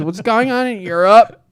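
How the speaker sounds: high-pitched imitation of a pundit; hammed-up rising pitch, mimicking her; mock pundit. Host imitating a TV pundit mentioning Europe, high mock voice. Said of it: "because she goes".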